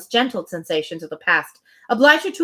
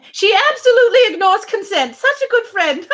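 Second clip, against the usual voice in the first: high, loud mock praise through a laugh; sarcastic aside; 10 semitones higher, 4 dB louder than usual. Real, not acted. Reader mocking Mrs Elton to her co-host, high and laughing. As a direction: sneer at the "kind" friend while laughing.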